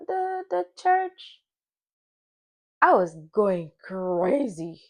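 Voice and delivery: fighting back tears at the memory; voice breaking. Real, not acted. Telling viewers about missing the viewing, voice breaking.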